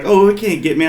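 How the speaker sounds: high, lightly voiced naive confidence; mock carefree. Sister-in-law dismissing the gator, high mock carefree. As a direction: brush it off airily. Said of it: "she was like".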